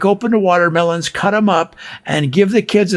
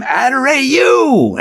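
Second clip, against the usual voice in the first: drawn-out, higher call of Adorayu; calling out the winner; 8 semitones higher, slower than usual. Retold or imitated. A bingo caller answering the crowd, drawn-out call. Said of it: "he'd say back to them".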